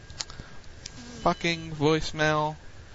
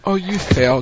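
louder, higher scolding; mock scolding; 4 semitones higher, 6 dB louder, slower than usual. Real, not acted. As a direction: tease him for failing. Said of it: "He was just doing the G M voice".

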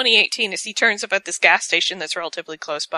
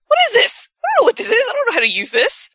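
high, loud, panicky confusion; mock baffled JFK; 4 dB louder, much faster than usual. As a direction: play a lost young man staring at an iPhone. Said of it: "and he's like".

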